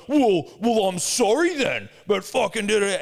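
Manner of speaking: high, loud sarcastic apology; mock defensive whine. Young golfer answering dad, mock petulance. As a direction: imitate a cornered kid's petulant comeback. Kid's defensive whining comeback, retold.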